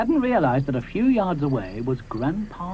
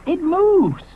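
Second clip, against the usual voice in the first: high firm insisting; insist, emphatic; insistent Moschops; 5 dB louder than usual. Acted. Moschops argues with Ally, high insistent voice.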